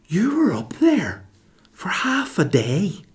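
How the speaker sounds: high, drawn-out exasperated protest; incredulous retort. Retold or imitated. Husband to wife who kept them waiting, incredulous.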